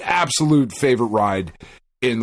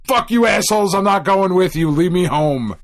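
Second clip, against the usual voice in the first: higher, mock-angry yell at the parents; teen outburst; 6 semitones higher than usual. Teen yelling at his parents to leave him home, mock angry. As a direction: shout it like a fed-up teenager. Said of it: "I was eventually like".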